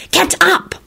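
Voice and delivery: high, urgent command; angry whisper. A narrator's reading. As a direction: order them up crossly in a high voice. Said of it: "Esther whispered angrily".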